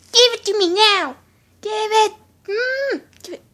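loud insistent demand easing into a whine; whiny demand. Pretend. Viewer still wanting the cookies, whiny demand. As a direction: demand it, then whine when refused.

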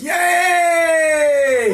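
long, high, loud cheer. Acted. Co-reader celebrates the socks' reunion, cheering. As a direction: whoop a drawn-out yay.

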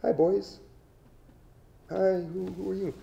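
lifted high, sweet greeting as the lawyer's assistant; female assistant voice. Retold or imitated. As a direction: play the polite assistant saying hi, pitched well up. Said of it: "Joanna speaks some English, it's his assistant".

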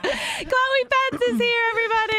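high, drawn-out announcer hype through laughter; excited introduction. Real, not acted. Host welcoming the guest, excited announcement.

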